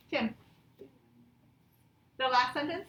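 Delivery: ordinary delivery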